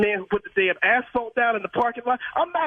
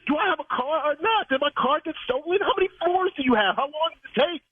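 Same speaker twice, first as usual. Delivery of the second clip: raised, heated questions at the manager; angry disbelief; 6 semitones higher than usual. Real, not acted. Car owner to parking manager, raised furious questioning. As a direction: demand answers, furious. Prank victim thinks car stolen.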